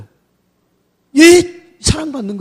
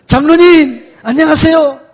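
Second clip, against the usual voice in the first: much higher, much louder, calling out; loud greeting; 7 semitones higher, 9 dB louder, slower than usual. Shown as a demonstration. Pastor shows the congregation how to hail the elders, loudly. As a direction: greet the elder with full-voiced joy. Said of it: "이렇게 가지 말고".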